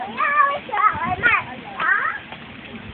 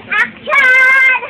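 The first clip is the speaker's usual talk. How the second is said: loud, higher call of action; 4 semitones higher, 8 dB louder than usual. Pretend. Child calls action in play, shouting. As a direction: call it out like a cue.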